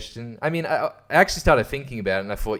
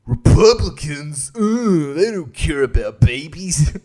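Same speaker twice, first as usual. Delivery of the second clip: preachy comic impression; 3 semitones higher, 6 dB louder, slower than usual.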